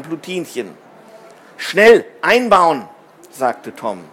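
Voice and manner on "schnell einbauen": loud, drawn-out command; forceful, stretched words; urgent order. A narrator's reading. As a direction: give an emphatic order to hurry. Urgent order during space repair.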